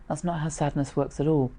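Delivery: usual speaking voice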